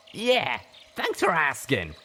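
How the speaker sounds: slow, sarcastic griffin reply; snarky. A narrator's reading. Griffin answers Life Bloom's question with slow sarcasm. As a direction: drip sarcasm on the thanks. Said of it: "snarked the griffin".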